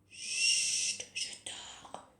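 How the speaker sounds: hushed sleepy thumb; sleepy whisper. Pretend. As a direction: whisper like you're half asleep. Sleepy thumb character answers.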